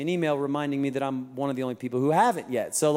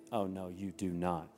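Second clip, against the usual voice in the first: low, much softer inner grumble; muttered to himself; 11 semitones lower, 13 dB quieter than usual. Retold or imitated. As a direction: say it under your breath. Dad's inner reaction.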